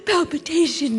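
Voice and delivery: high gasps, wordless terror breaking into words; hyperventilating in a panic; panicked gasping. Acted. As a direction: gasp for air as panic takes over. Panic attack, gasping for air.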